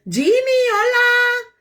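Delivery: high, loud, slow sing-song hello; excited greeting. Real, not acted. Realtor greets a viewer joining, high excited hello. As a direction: call out hola happily.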